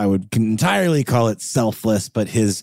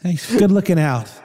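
higher-pitched, playful wife's reply; lightly voiced wife; 9 semitones higher than usual. Wife thanking husband for lookout, lightly put-on high voice.

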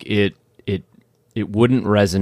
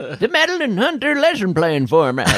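put-on old man voice; 12 semitones higher than usual. Mock old-timer voice.

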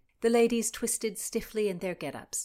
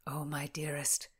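whisper; 4 semitones lower than usual. Acted. Escort leaning in closely.

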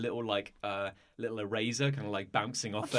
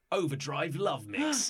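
slow, higher-pitched title call; game announcer; 5 semitones higher, slower than usual. Acted. Game master reads the flashing screen, drawn-out announcer call. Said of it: "the screen kinda flashes and it goes".

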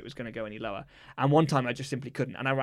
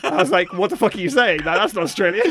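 laughing; 5 semitones higher, 7 dB louder than usual. Real, not acted. Laughing at own bad impression.

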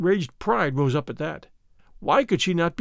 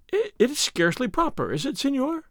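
put-on higher, mildly timid girl's voice; hesitate, a touch afraid; lightly voiced anxious young lady; a bit frightened; 8 semitones higher than usual. A narrator's reading. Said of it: "a bit frightened".

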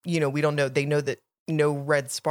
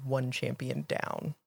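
cold inner voice; 4 semitones lower, 8 dB quieter, slower than usual. Retold or imitated. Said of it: "a voice in his head that's saying".

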